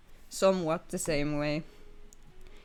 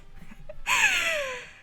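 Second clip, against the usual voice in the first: sudden high giggle breaking into the line; high burst of laughter. Real, not acted. Streamer laughing at her own story, high giggle.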